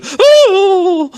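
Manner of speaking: high, loud mock squeal of surprise through a laugh; startled toaster yelp. Mocking the film, he voices a toaster yelping in surprise. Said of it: "And the toaster's going".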